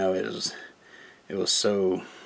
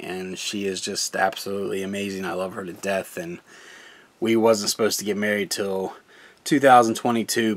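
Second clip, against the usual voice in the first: ordinary talk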